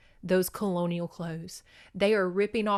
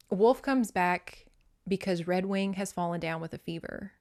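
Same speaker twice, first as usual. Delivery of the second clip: ordinary delivery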